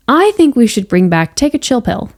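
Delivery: ordinary delivery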